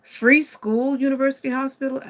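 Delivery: high and loud, nearly shouting each item; excited listing. Real, not acted. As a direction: rattle off the perks thrilled. Repeating free services in delight.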